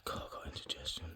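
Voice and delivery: hushed, quick whisper under the breath; muttering aside about indigestion, whispered. Real, not acted. Muttered aside about his stomach.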